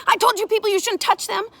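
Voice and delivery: high, very loud scolding; shouted accusation. A narrator's reading. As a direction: lash out loudly in self-defence. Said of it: "she shouted".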